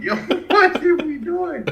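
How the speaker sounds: high, drawn-out mock protest; high-pitched retold exasperation; exasperated disbelief. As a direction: stretch it out in baffled, laughing complaint. Acting out his bewilderment at Rick.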